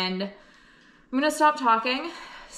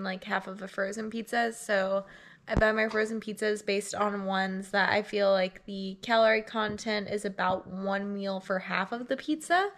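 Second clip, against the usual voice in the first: usual speaking voice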